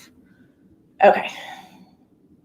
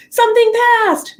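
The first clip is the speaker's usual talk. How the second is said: quoted viewer blurting it, much higher and louder; confused viewer; 13 semitones higher, 5 dB louder than usual. Retold or imitated. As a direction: play a viewer who just tuned in. Viewer voiced jumping in.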